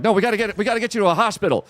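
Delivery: high, fast, frantic pleading; panicked plea. Retold or imitated. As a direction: beg them urgently to go to the hospital. Friends panicking over injured man.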